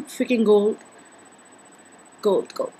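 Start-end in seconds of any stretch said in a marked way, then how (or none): none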